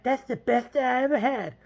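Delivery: high falsetto woman; mock girl voice. Girl praises James afterwards, mock falsetto. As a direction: imitate her in a high, put-on voice.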